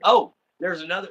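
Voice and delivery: ordinary delivery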